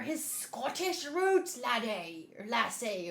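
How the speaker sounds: put-on half-hearted Scottish accent, drawn out; badly faked Scottish lilt; attempted Scottish accent. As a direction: have a clumsy go at a Scottish accent. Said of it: "I can't do a Scottish accent".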